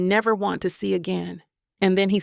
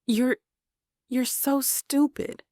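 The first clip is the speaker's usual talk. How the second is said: tearful whisper; 5 semitones higher than usual. A narrator's reading. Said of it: "I whisper".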